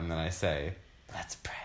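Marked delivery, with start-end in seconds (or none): 1.1-1.5 soft breathy whisper, nearly no voice; breathy hollywood starlet. Pretend. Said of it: "In my breathy voice".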